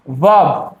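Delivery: वर्ब said as vab, no R; low, isolated वर्ब with the R dropped; silent R. Shown as a demonstration. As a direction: leave out the R in वर्ब. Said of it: "आर silent है".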